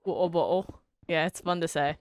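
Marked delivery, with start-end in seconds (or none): 0.0-0.8 British accent with dropped t and no r. Shown as a demonstration. Streamer tries chat's phrase in a British accent. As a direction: clip it the British way, wo-boəl. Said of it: "Oh, in a British accent".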